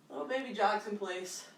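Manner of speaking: ordinary talk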